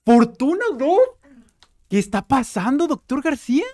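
loud, high-pitched excited shouting; ecstatic disbelief. Real, not acted. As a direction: shout it, amazed at your luck. Reacción eufórica al encontrar Fortuna. (gritos de euforia e incredulidad)

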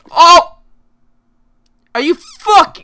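real high, very loud scream of frustration; outraged yell. Real, not acted. Player after a sudden game mishap, furious high yell. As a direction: explode in a sudden high shout.

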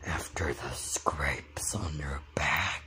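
low spooky whisper; creepy whisper. Acted. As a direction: breathe it out eerily. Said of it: "Doing that voice".

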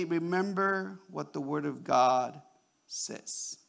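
low, slow, softly measured reminder; calm. Shown as a demonstration. Upset parent reminding children of God's Word, calm. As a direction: bring it right down, unhurried and gentle. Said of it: "what you can do is calmly say".

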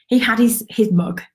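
ordinary talk, nothing marked

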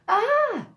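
high, loud admiring "ah"; admiring sigh. A narrator's reading. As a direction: sigh with delight. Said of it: "sighed the animals".

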